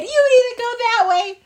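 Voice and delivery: high, loud hyena directions; hyena crying with laughter. Acted. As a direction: call it out high as an overcome hyena. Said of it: "they were crying so hard".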